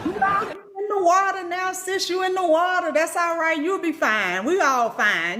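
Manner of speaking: high, sing-song mock soothing; patronizing comfort. Comforting an overwhelmed newcomer, high sing-song mock reassurance. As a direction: pat them on the head with your voice. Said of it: "I'll be like".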